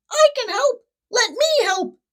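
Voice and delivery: put-on high squawky baby seagull; squawking baby gull. A narrator's reading. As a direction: squawk it out like an eager little bird. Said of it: "and squawked".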